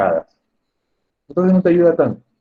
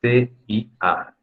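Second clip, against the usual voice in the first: spelled out slowly; 3 semitones higher, much slower than usual. Shown as a demonstration. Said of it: "¿Cómo se abrevia esta palabra?".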